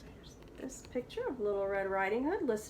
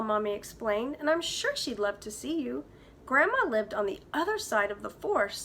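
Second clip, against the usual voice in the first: put-on lively read-aloud for kids; story narration; 4 semitones higher than usual. A narrator's reading. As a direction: tell it like a bedtime storybook. Teacher reads story aloud.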